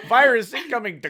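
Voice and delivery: hammed-up movie warning; mock alarm. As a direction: call out a mock virus alert. Mock computer alarm while riffing.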